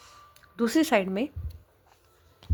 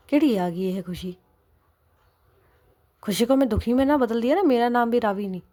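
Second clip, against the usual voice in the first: louder, pushing back hard; indignant threat; 3 semitones higher, 5 dB louder than usual. Acted. Wife rejecting husband's plea to cool down, loud indignant threat. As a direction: scoff at being told to calm down, then threaten.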